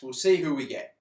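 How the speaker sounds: usual speaking voice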